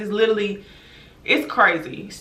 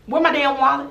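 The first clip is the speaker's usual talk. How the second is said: higher, indignant question; retold angry demand; 6 semitones higher than usual. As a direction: push the demand up a notch. Retelling her wallet confrontation, escalating.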